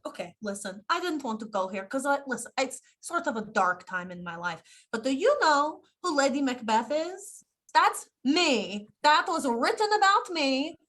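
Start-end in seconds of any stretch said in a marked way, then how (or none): 4.9-10.8 rising higher and louder into a threat; menacing boast building to a yell. Acted. Character threat escalates.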